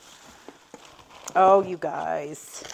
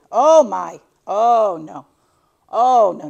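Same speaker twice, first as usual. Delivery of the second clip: loud startled oh no; shocked exclaim; 13 dB louder, slower than usual. Real, not acted. To her viewers, trying on tiny glasses, loud shocked exclaims. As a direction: react in loud disbelief.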